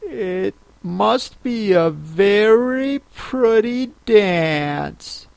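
put-on high, slow, gentle girl's voice; timid. A narrator's reading. Alice politely praises the dance, timidly. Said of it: "said Alice timidly".

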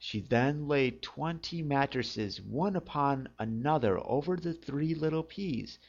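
usual speaking voice

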